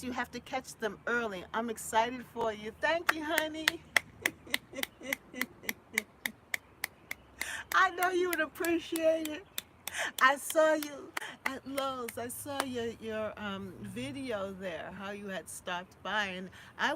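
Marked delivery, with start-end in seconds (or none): 2.8-12.2 high, loud thanks; happy thanks. Real, not acted. Vlogger thanking a viewer, loud and high.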